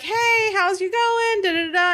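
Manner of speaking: high, singsong cheery greeting; lightly mocking singsong nurse; chirpy nurse. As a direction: breeze in like a too-cheerful nurse.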